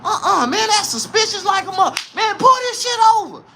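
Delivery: high, laughing cop voice; mock trooper. Troopers spotting the truck, high laughing mock cop. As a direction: play the spooked cop. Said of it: "You like".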